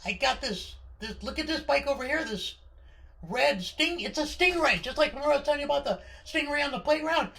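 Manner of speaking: put-on high, loud, rushing kid pleading; excited kid. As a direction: tumble the words out in boyish excitement. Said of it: "I said".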